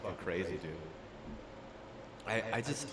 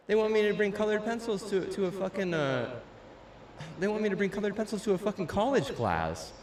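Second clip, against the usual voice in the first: higher, disbelieving rant voice; outraged high voice; 8 semitones higher than usual. Retold or imitated. Complaining about a college class, he rants in a higher outraged voice. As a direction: squeal it out in disbelief.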